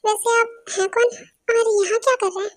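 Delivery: normal talking voice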